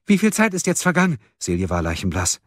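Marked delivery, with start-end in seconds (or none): 0.1-1.2 high, rushed panicked question. A narrator's reading. Friends against the clock, panicked. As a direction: blurt it out in sudden panic. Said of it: "Schlagartig breitete sich Panik aus".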